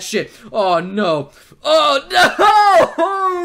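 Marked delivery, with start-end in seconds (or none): 1.6-3.4 high, loud, drawn-out wail; dismayed outburst. Real, not acted. Alarmed by the story's turn.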